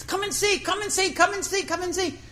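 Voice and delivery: high eager repeated calls; captain calling other captains to come look; excited calling. Retold or imitated. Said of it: "running up and down the dock".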